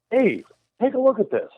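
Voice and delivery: high-pitched retold call for attention; imitating an eager call-out.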